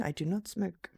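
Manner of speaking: normal talking voice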